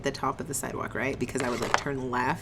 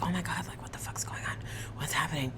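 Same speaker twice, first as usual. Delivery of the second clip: hushed disbelief; 3 semitones higher, 9 dB quieter than usual. Retold or imitated. Retold confusion at car chase.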